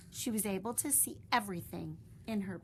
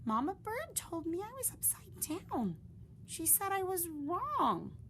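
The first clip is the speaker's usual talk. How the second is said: high bewildered little bat; confused young bat; 11 semitones higher than usual. Acted. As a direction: puzzle it out. Stellaluna confused.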